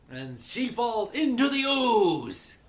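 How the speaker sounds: high, loud, stretched-out storytelling; melodramatic narration. Real, not acted. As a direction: cry it out as the climax. Dramatizing the princess's fall.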